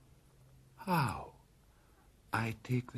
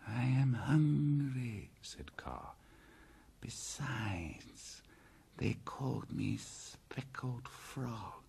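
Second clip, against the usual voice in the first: hissing snake; 5 dB quieter than usual. Acted. Python Kaa answers Bagheera, hissed and sulky. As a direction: breathe it out like a sulking python.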